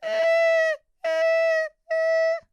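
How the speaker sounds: a tight, high, squeezed-off "eh"; mock a singer choking with a tense jaw; strained squeak. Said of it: "'cause if your jaw is tense".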